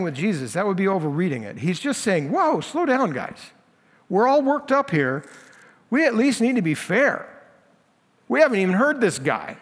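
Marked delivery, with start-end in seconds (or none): 2.3-9.6 calming plea. Acted. Nicodemus calms angry leaders.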